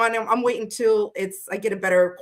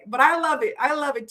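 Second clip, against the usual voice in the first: normal talking voice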